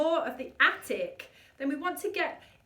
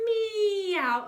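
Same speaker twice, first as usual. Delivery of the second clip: higher, louder meow; kitten meow; 7 semitones higher, 6 dB louder than usual. Shown as a demonstration. Coach singing a kitten meow. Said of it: "let's pretend to be a kitten".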